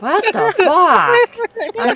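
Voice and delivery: loud, high, drawn-out what the fuck; shocked outburst. Real, not acted. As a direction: blurt it out in slow, loud shock.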